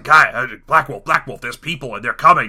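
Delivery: lizard henchman, low and urgent; put-on panicky minion voice; Larry. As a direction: blurt a loud warning to the boss. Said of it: "this lizard that works for him named Larry".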